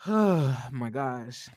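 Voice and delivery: slow, sighing oh my gosh; drawn-out weary sigh then the words; exasperated sigh. Real, not acted. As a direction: sigh it out, fed up. Exasperated after ranting about Martell.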